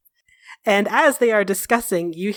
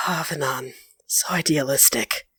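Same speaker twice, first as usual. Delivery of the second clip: lower, softer, pitying sigh of a voice; Solas, weary and condescending; 7 semitones lower, 5 dB quieter than usual. Acted. Solas pitying Venan's idealism, lower and softer. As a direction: talk down to him gently, a little sadly.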